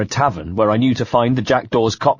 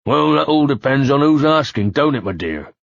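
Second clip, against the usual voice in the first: put-on leering heavy West Country accent, higher; a thug teasing in broad West Country; 4 semitones higher than usual. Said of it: "in a heavy West Country accent".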